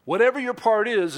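high, loud WHATEVER; ringing title line; proclaimed title. Shown as a demonstration. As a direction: announce the sermon title. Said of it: "sermon title today".

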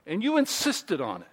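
usual speaking voice

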